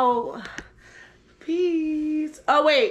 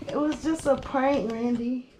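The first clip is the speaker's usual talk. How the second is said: ordinary delivery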